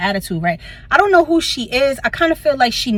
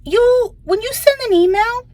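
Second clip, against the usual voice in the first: high sugary scolding; 12 semitones higher, 3 dB louder than usual. Retold or imitated. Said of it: "And I'm like".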